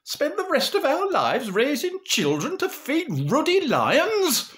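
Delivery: loud, very high Northern outrage; hammed-up furious Lancashire mother; blazing mother. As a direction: build indignantly to a loud refusal. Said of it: "Mother got proper blazing".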